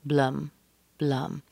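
"blum" short and weak with an uh vowel; schwa. Shown as a demonstration. Teacher models the second syllable, a weak schwa "blum". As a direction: let "blum" drop to a small neutral uh. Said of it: "has a schwa".

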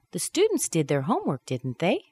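"didn't they" rising at the end; rising tag, unsure. Shown as a demonstration. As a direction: lift DIDN'T THEY as a real question. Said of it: "I'm not really sure".

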